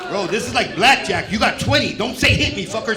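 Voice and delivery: loud, high and pumped; excited. Acted. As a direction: hype it up like a gambler. Excited blackjack bit.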